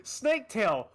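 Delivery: ordinary talk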